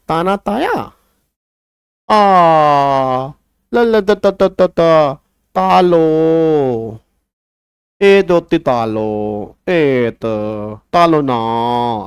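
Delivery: slow, drawn-out mock toddler lisp; mocking baby talk. Teacher mocking adults who text in baby talk, slow lisping. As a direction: ham up the grown adult's baby-talk message. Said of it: "लड़के लड़कियां तोतले हुए पड़े हैं".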